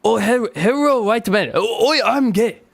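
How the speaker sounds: exaggerated Japanese accent, played for laughs; mock Japanese accent. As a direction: imitate a caricatured Japanese greeting. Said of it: "that's how they open every conversation".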